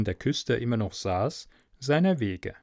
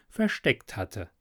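ordinary delivery, nothing marked

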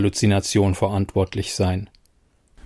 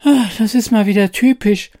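much higher squeaky fairy, weary sigh; a tiny fairy sighing at humans; 10 semitones higher, 4 dB louder than usual. Acted. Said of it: "seufzte die Fee".

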